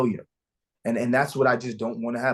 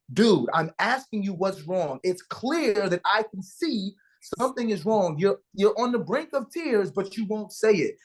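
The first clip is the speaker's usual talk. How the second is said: higher, drawn-out frustrated appeal; exasperated pleading; 8 semitones higher than usual. Retold or imitated. Dad silently urging his son to open up, exasperated pleading. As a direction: plead with him in a strained high pitch, stretching the key words.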